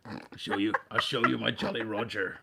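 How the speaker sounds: playful pirate talk; lightly put-on pirate captain. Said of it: "Why am I still talking like a pirate?".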